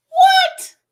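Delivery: high shocked shriek; shocked shout. Real, not acted. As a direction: yell in disbelief. Shocked at audiobook line.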